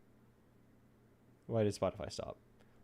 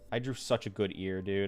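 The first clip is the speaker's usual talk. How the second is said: ordinary talk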